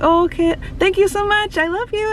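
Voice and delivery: high, cutesy imitated idol voice; high-pitched sweet mimicry. Imitating idol's sweet farewell.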